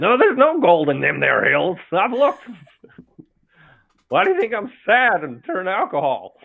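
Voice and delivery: put-on high, loud southern old-timer; old southern prospector. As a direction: holler like a cranky gold prospector. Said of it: "southern prospector voice".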